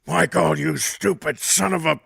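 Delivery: exasperated boss bawling out an announcer; Vince. Acted. Boss berating announcer over headset, loud and exasperated. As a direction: scold him loudly, biting off each phrase. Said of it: "Vince comes on in our, in our, uh, headsets and goes".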